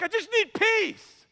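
exasperated high plea for peace; desperate plea. Retold or imitated. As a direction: beg for it, voice way up. Pleading with his counselor.

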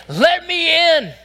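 a high, loud hint of Christ at the door; lightly voiced Christ. Preacher voicing Christ at the box, lightly.

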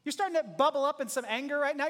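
ordinary talk, nothing marked

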